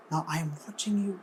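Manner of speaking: very soft and close; hushed God voice. Acted. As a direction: say it like a quiet secret promise. God's voice, spoken close.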